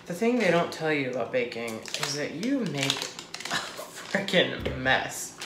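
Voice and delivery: normal talking voice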